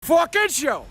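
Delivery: shouting. Real, not acted. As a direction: belt out the end. Finishing hype welcome.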